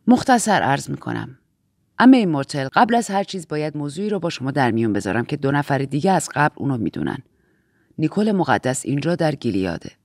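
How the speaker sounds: low-pitched, measured authority; low, grave briefing voice; composed Aunt Lydia figure. Acted. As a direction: speak as the aunt in charge, pitched well down.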